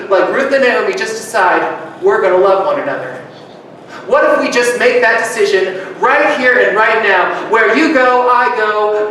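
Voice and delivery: usual speaking voice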